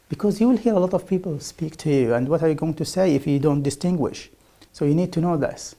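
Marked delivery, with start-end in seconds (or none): none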